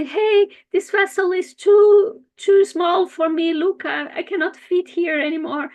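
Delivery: high, loud fish voice complaining. Acted. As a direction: protest that the pot is too small. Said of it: "the vessel and say".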